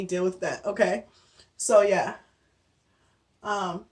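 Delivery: usual speaking voice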